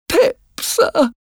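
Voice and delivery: put-on very high, timid child's voice; frightened boy. A narrator's reading. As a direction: squeak out a scared answer. Frightened boy answers again.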